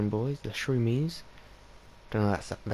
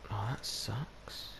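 whispered; 9 dB quieter, much slower than usual. Real, not acted. Muttered disappointment aside.

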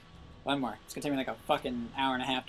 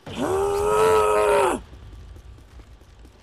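loud, sustained high shriek; long high scream; 7 dB louder than usual. Real, not acted. Long wordless outburst while playing.

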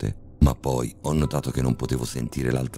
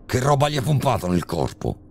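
raised, threatening father's voice through clenched teeth; through gritted teeth; 9 semitones higher than usual. A narrator's reading. Father holding a gun on the doctor, menacing through gritted teeth. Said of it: "disse mio padre a denti stretti".

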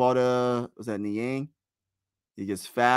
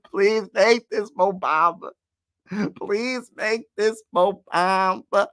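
high, slow begging cry; desperate pleading; 8 semitones higher, slower than usual. Real, not acted. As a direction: beg the shot to drop, stretched out and high. Begging during clutch free throw.

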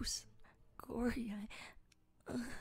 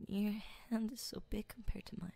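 whisper; 4 dB quieter than usual. Acted. Whispered ASMR roleplay.

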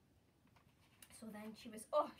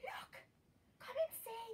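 higher, hushed cub in shock; mildly shocked little bear; shocked Baby Bear; 6 semitones higher than usual. Acted. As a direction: alert the others in a small, startled voice. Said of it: "he was very shocked. He went".

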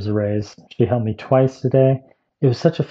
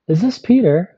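lovely voice; 7 semitones higher, 3 dB louder than usual. Retold or imitated. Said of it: "this person with a lovely voice said".

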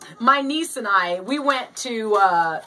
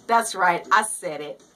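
normal talking voice

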